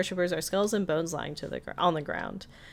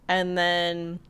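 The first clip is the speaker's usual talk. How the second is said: ordinary delivery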